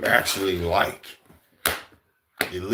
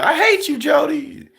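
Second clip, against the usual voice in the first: quoting Yvette. Retold or imitated.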